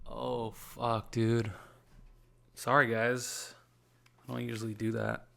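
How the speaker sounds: apologetic after crying; recovering from tears. Real, not acted. Podcaster apologizing to listeners, choked after crying. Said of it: "Crying, throwing up".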